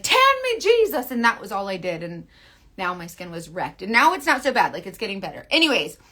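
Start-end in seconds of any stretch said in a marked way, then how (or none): 0.0-1.1 high, loud hammed-up cry; mock plea. Her past self begging for a tan, mock pleading. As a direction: belt it out like a worshipper.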